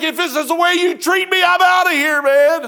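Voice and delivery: loud, high, furious outburst at God; angry shout. Retold or imitated. Sailor storming off the ship, shouting in anger. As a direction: yell it like you're quitting for good. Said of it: "I was so mad I could spit".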